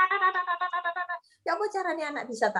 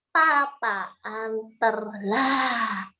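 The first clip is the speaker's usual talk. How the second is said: ordinary delivery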